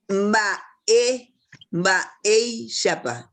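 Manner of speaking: "ba-, ey, shapa" split up, weight on "ey"; syllable-by-syllable "ba-, ey, shapa", "ey" drawn out; stress on E. Shown as a demonstration. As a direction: slowly break off "ba-", then lean on "ey". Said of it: "E cargamos más la voz".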